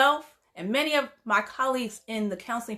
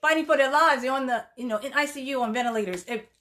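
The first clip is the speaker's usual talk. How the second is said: normal talking voice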